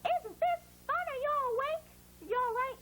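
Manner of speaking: high, bright puppet-like voice for the letters; letters chatting. Pretend. The Q and U letters greeting the class in a high puppet voice. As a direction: squeak it up as a cheerful little character. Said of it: "these two letters together say".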